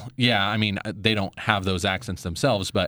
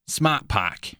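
put-on New England accent; 4 semitones higher than usual. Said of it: "using their New England accents".

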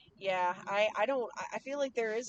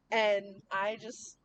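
ordinary delivery

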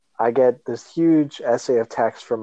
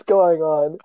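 usual speaking voice

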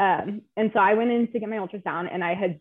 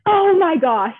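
shocked exclamation, much higher and louder; big surprised gasp; 10 semitones higher, 10 dB louder, slower than usual. Retold or imitated. Ultrasound tech reacting to the screen, with a loud surprised exclamation. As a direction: burst out in stunned surprise. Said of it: "the biggest reaction ever".

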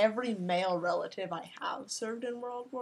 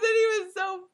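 laughing hard; 11 semitones higher, 5 dB louder than usual. Real, not acted. Laughing hard while telling.

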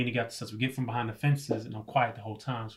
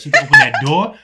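laughing hype-up; 10 semitones higher, 15 dB louder, faster than usual. Retold or imitated. Hyping himself up to fight.

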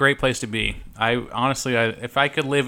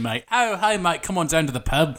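raised-pitch mock Aussie; Australian accent; 3 semitones higher than usual. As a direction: slip into a cartoon Australian accent. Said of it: "go into Australian".

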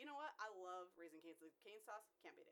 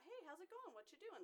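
much higher, chirpy street greeting; friendly neighbour; 11 semitones higher than usual. Retold or imitated. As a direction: call out cheerfully like a chatty local.

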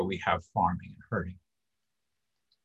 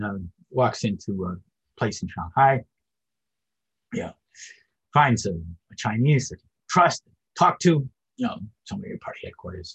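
ordinary talk, nothing marked

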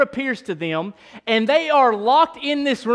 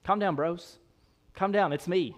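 acting out a soft, lower reassurance; calming them down; 3 semitones lower, 6 dB quieter than usual. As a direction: gently talk down panicked friends. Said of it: "Jesus has to calm them down".

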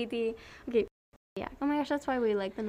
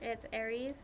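usual speaking voice

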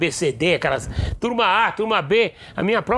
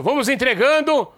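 teacher calling out; 6 semitones higher than usual. Retold or imitated.

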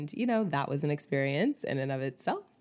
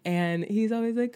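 usual speaking voice

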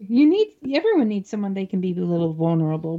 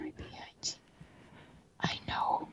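heavy breathing phone whisper; 17 dB quieter, much faster than usual. Pretend. Creepy stalker whisper joke.

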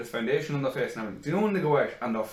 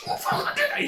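mock angry outburst; slower than usual. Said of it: "You get so angry at it".

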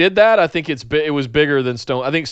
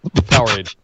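usual speaking voice

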